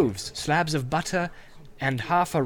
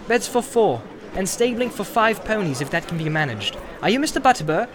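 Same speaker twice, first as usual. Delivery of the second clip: polite hobbit; 5 semitones higher than usual. Acted. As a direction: ask politely but clearly. Frodo answers landlord.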